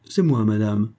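ordinary delivery, nothing marked

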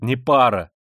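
loud bitter cry; flinging it out; bitter shout. A narrator's reading. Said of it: "вскричал он опять".

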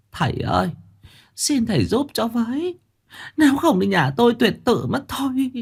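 put-on high, pleading old woman's voice; giọng bà cụ van lạy. A narrator's reading. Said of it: "chắp tay mà van mà lạy". (giọng bà lão cao, van nài)

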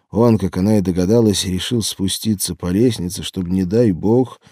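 ordinary delivery

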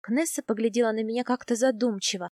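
ordinary delivery, nothing marked

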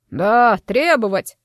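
a slow, loud shout of agreement; rally the crowd with it; shouted echo. A narrator's reading. Mr. Katz shouts, turning to rouse the crowd. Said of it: "закричал господин Кац".

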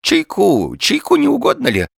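high, loud, fussing host; flustered old man urging hospitality; babbling old man. A narrator's reading. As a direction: offer it eagerly in a high old voice. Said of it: "бормотал старик".

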